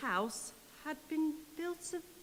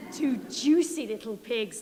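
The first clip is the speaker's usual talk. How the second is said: louder, relishing wolf; gloating wolf; 6 dB louder than usual. Acted. Wolf gloating after eating, louder. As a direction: smack the lips over it. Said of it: "the wolf said".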